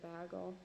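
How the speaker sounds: "bagel" said quickly as BAG-ul; disliked bagel pronunciation. Shown as a demonstration. Saying she really hates this version, "bagel" as BAG-ul. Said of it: "I hate the way people say bagel".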